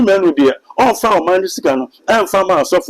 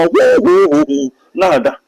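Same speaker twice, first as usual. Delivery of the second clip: mock pastor prayer cries; 6 semitones higher than usual. Mocking how a pastor cries hoo while praying on the ground. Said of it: "wo papa asɔfo abɔ mpae a".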